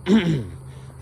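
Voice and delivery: noisy throat-clear, acted out; imitated throat-clearing. Imitating dad making sounds in silence, pointed throat-clearing. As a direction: clear the throat to mimic a needless noise. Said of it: "He will go".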